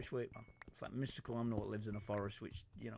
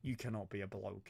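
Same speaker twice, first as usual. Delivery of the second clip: ordinary delivery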